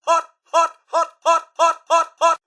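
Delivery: high, loud staccato yelps; rapid-fire high cries of alarm; yelping from heat. Acted. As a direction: yelp each word as if scalded. Robot yelps at something hot.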